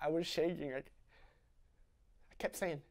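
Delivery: ordinary delivery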